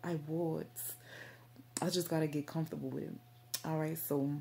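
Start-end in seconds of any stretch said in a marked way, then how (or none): 0.0-0.7 lightly put-on British accent. Shown as a demonstration. Offering viewers a British accent, lightly put on. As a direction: try out a hint of a British accent. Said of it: "talk in this British accent".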